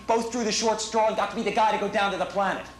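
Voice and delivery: ordinary talk, nothing marked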